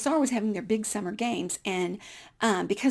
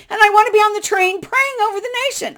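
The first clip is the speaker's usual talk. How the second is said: mock zealot; 12 semitones higher, 11 dB louder, faster than usual. Mocking her own zealous reason.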